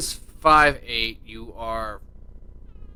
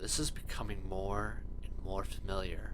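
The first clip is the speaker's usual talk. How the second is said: soft scene narration; 6 semitones lower, 16 dB quieter than usual. A narrator's reading. GM sets scene softly.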